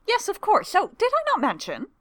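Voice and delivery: tossed off lightly, unbothered; breezy young woman voice. A narrator's reading. Tamara's breezy reply.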